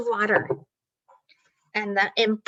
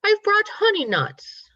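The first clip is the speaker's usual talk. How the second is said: cheery, high and louder offer; grinning badger; 7 semitones higher, 4 dB louder than usual. A narrator's reading. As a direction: offer treats with a grin, pitched up high. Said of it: "Badger says with a grin".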